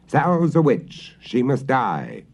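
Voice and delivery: loud, slow mob accusation; townfolk accusing. A narrator's reading. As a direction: cry out against the witch. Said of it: "the townfolk declared".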